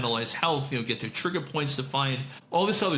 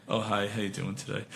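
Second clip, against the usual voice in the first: monotone greeting; 5 semitones lower, 5 dB quieter than usual. Retold or imitated. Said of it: "this monotone voice".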